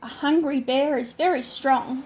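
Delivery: ordinary delivery, nothing marked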